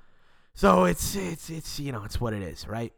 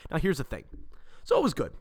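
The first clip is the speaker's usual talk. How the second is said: usual speaking voice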